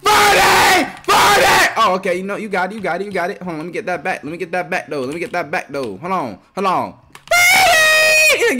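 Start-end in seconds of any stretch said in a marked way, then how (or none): 0.0-1.7 much higher, louder celebration; hype shout. Real, not acted. Gamer celebrating his made shot, shouting. 7.3-8.6 much higher, louder celebration; hype shout. Real, not acted. Gamer celebrating his made shot, shouting.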